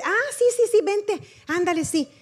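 high, breezy imitation of careless relatives; offhand agreement. Relative casually letting the visitor pray before cake, breezy and offhand.

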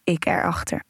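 ordinary delivery, nothing marked

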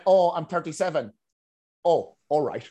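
normal talking voice

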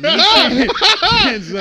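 words squeezed out through laughter; cracking up mid-sentence; laughing. Real, not acted. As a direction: laugh it out slowly, very high. Laughing while retelling.

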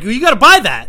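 high, loud indignant yell; hammed-up shouted demand; screaming. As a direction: scream it like an outraged store cop. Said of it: "I'm screaming that like that".

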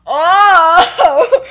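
loud, high, drawn-out oh; hammed-up shocked "oh"; scandalized gasp. Acting out shocked reaction.

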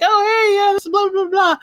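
high, chirpy social patter; acted-out cheery small talk; perky introduction. Acting out introductions to coworkers.

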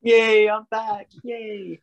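high, drawn-out yay; excited cheer. Real, not acted. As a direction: cheer your return in a high, stretched voice. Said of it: "been so excited for this".

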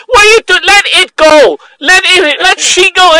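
high, loud imitated scolding; mock yell. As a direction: shout it like the upset lady. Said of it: "she yelled".

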